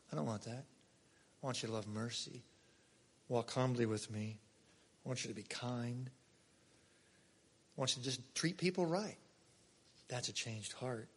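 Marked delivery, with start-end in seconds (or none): 0.1-9.2 softer, lower voice of God; tender God. Acted. Preacher voicing God pleading with his people, soft and tender. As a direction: speak as God gently pleading.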